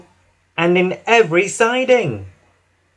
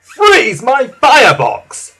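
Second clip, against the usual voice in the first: high, loud scandalised exclamation; Spencer; 10 semitones higher, 8 dB louder, slower than usual. A narrator's reading. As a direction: snort it out slowly and loudly. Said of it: "snorted Spencer".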